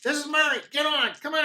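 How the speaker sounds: high, eager little driver calling out; gnome driver voice. Acted. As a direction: hurry the party along, chirpy.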